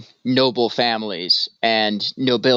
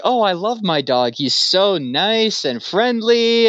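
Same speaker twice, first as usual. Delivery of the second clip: high, slow gushing over the pet; doting emperor; 8 semitones higher, slower than usual. Pretend. Emperor telling the visitor how much he loves his dog, high, slow and doting. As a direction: gush slowly about the beloved little dog.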